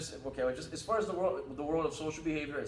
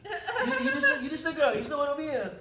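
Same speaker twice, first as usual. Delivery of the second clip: high, loud put-on creep voice with a laugh; mock guy's voice; 11 semitones higher, 6 dB louder than usual. Host mocking a guy hitting on the guest, high and loud. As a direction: ham up a pickup guy's line, laughing.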